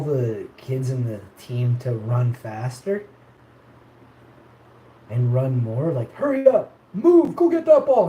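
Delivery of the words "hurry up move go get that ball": high, loud mock coach bark; retold coach yelling. Imitating the soccer coach yelling at kids, mock shout. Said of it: "he's screaming".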